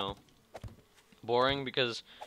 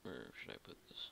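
whispering; 10 semitones lower, 17 dB quieter, faster than usual. Real, not acted. Muttering to self while looting.

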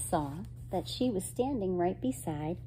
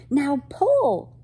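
high, loud shout; yelled command; 8 semitones higher, 9 dB louder than usual. Acted. Girl directs friend tugging a tablecloth, yelled command. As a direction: call out the order with urgency. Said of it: "yelled Katie".

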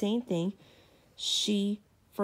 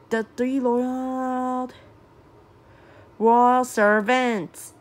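very slow, stretched, louder phrase; drawn-out weariness; 3 semitones higher, 7 dB louder, much slower than usual. A narrator's reading. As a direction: drag every word out like a tired sigh. Narrator drags out servants' weariness.

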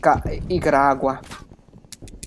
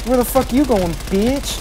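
excited taunt; 8 semitones higher, 3 dB louder than usual. Real, not acted. Chasing an enemy in game.

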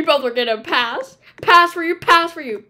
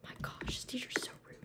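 quick secret whisper; 3 semitones lower, 21 dB quieter, faster than usual. Pretend. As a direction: whisper so the teacher can't hear. Kids whisper behind teacher's back.

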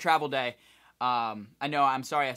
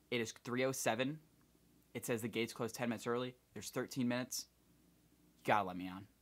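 quiet pleading; 4 semitones lower, 9 dB quieter than usual. Retold or imitated. Begging the gate manager.

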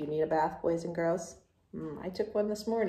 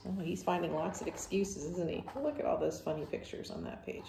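plain reading voice

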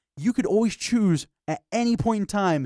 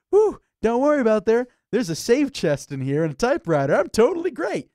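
loud, high, cocky relief; mock relieved cheer; 5 semitones higher, 4 dB louder than usual. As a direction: act falsely safe and chuffed. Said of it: "you're like".

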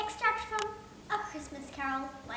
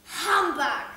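a louder, harsher "Humbug!" spat out; loud scornful "Humbug!"; 4 semitones higher, 12 dB louder than usual. Acted. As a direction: bark the word in disgust.

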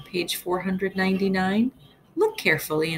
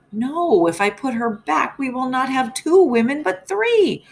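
higher, louder panicked husband's yell; 7 semitones higher, 4 dB louder than usual. Acted. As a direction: yell it back at your angry wife, climbing on three. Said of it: "Mister Hacktack yelled".

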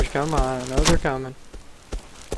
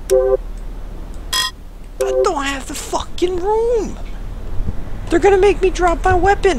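higher, slow, drawn-out complaint; a slow, high, exasperated wail at the full inventory; exasperated whine; 14 semitones higher, slower than usual. Real, not acted. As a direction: stretch it out in disbelief at the game.

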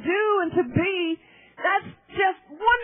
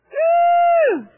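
long high, loud woo; ecstatic whoop; 12 semitones higher, 7 dB louder than usual. Real, not acted. Preacher overcome before her congregation, ecstatic whoop. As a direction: let out a soaring loud whoop.

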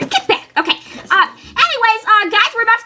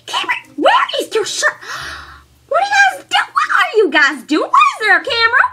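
scolding mom; 7 semitones higher than usual. Acted. Mom scolds shirtless son.

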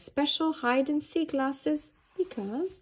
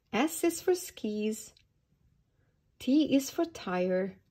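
usual speaking voice

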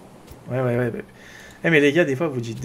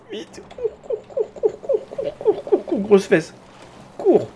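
much higher, frantic chant of cours; panicked urging; slower than usual. Real, not acted. Gamer urges his character away from the shark, in a high panicked squeal. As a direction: squeal at the character to run for its life.

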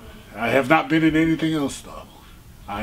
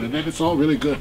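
ordinary delivery

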